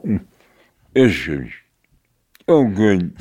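talking through a mouthful; words through sticky peanut butter; mouth full of peanut butter. Retold or imitated. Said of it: "when you got peanut butter in your mouth, you go".

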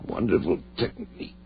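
faint, broken words; dying gasps. Acted. Dying Ed gasps to David, faint broken words. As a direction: fade out with each breath.

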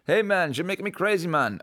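high-pitched put-on Jamaican accent. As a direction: imitate people doing a stock Jamaican accent. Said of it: "do the Jamaican accent".

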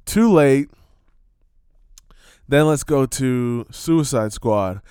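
ordinary delivery

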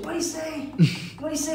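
high-pitched, insistent repeated question; mock pestering passenger. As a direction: imitate someone who never hears. Same passenger asks again.

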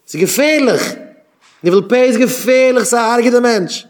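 high-pitched, loud outcry of warning; alarmed warning. Real, not acted. Speaker warns listeners about foul group talk, alarmed outcry. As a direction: cry out that it is dangerous, pitch leaping up.

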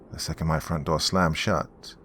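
ordinary delivery, nothing marked

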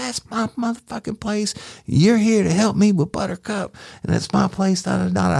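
high-pitched imitation of an angry, cursing woman; Miss Mary imitated. Deputy retelling the woman yelling back at him, high mock-angry voice. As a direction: squeak her up high, all attitude.

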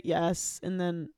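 usual speaking voice

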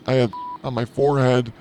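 normal talking voice

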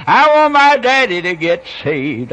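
put-on loud, high child's wail; little girl crying out. Preacher retelling the girl's plea on radio, crying out. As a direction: cry out like a pleading small child. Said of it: "cried out".

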